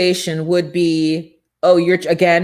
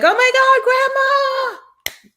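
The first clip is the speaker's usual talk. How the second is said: excited grandchild; 3 dB louder, much slower than usual. Retold or imitated.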